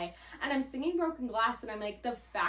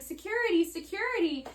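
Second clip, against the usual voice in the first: high, repeated sing-out label; comically obvious, raised-pitch repetition; mock signposting; 10 semitones higher, 4 dB louder than usual. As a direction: call out each sign in exaggerated high pitch. Mimics repeated airport signs.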